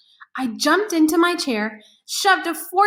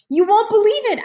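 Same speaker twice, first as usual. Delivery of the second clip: excited; 7 semitones higher, 5 dB louder than usual. Acted. Boy bursting with news.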